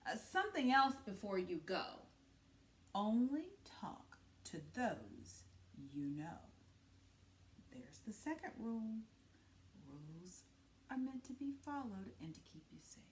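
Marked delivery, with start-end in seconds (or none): 2.9-6.4 slow hushed whisper; soft unvoiced whisper. Mother's secret rule, whispered. 7.7-9.0 hushed aside; quiet unvoiced whisper. A narrator's reading. 9.9-13.0 hushed aside; whisper. A narrator's reading.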